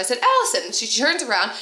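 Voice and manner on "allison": much higher calling out; retold second try at hailing someone, pitched right up; mock yell. Calling a catering worker who didn't answer, mock yell. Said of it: "so I yell out".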